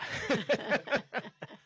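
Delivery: sudden high laughter; high nervous laugh. Real, not acted. Laughing at the interviewer's hard question.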